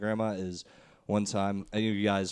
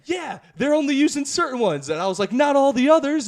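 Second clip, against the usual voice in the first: put-on higher, insistent kid arguing; heated kid; 9 dB louder than usual. Grandson insisting to grandma, getting louder. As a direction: push back like a stubborn ten-year-old.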